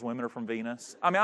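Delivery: ordinary delivery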